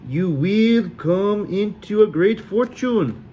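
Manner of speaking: slow, high, hammed-up Sully accent; grand mock Sully prophecy; slow fake Sully fortune telling. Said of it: "the most outrageously Sully accent".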